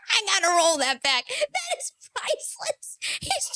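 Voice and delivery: squeaky, breaking laugh over the words; helpless laughter. Real, not acted. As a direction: laugh so hard the voice cracks high. Cracking up at the clip.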